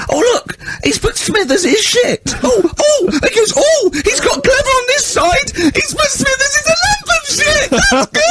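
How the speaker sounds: very high, slow, sarcastic wail; hysterical teacher. Retold or imitated. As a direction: shriek it with bitter mock praise. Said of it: "He went".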